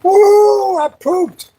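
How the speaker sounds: high, loud whoop of fake triumph; mock cheer. Cheering to his fishing buddy about pooping, whooping high. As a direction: celebrate it like a victory.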